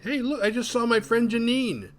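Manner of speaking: high, gushing mock delight; giddy excitement. Laura seeing her dead friend, giddy excitement. Retold delusional wife.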